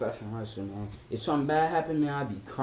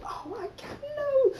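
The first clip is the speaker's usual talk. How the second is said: shocked cry; slower than usual. Retold or imitated. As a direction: squeal it out in disbelief. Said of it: "I was like".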